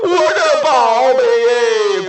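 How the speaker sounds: slow, stretched mock lament; mock wailing. Taoist mourns his broken treasure in a slow mock wail. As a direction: wail over the lost treasure, dragging it out. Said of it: "这俩都哭了".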